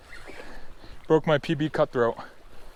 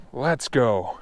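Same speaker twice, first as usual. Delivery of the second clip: ordinary delivery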